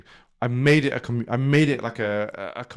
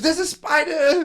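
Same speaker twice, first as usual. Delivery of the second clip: put-on high, excited child's cry; excited little kid; 14 semitones higher, slower than usual. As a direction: call out like a startled toddler. Son alarmed about a spider.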